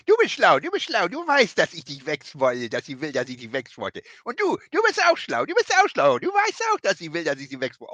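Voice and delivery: high, loud mocking whine, repeating herself; parodied Nia Jax. Co-host parodies Nia Jax repeating her comment, high mocking whine. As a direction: ham up the dim wrestler saying the same thing over and over.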